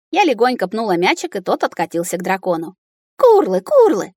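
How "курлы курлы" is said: high, loud happy creature calls; delighted dragon. A narrator's reading. Dragon thrilled she kicked the ball, delighted. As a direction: chirp it twice in high glee. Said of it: "обрадовался он".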